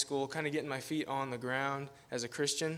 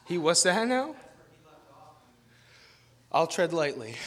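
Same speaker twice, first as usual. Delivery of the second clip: louder amused reply with laugh; 4 semitones higher, 6 dB louder than usual. Real, not acted. Guest pastor answering a joke from the congregation, laughing and louder. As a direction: laugh it off good-naturedly.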